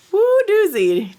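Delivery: high, loud whoop; excited whoop. Real, not acted. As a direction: belt out a gleeful woo. Exclaims at spell hit.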